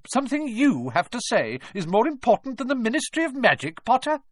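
high, indignant challenge, rising at the end; incredulous. A narrator's reading. McGonagall doubts Harry's news matters, indignant disbelief. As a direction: question the pupil's nerve.

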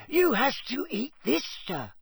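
squeaky, high-pitched insistent elf; Dobby. A narrator's reading. Elf insisting Harry can do the task, squeaking. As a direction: squeak it with utter conviction. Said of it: "squeaked the elf".

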